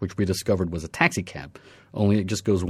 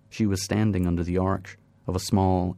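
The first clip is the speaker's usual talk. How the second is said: ordinary delivery, nothing marked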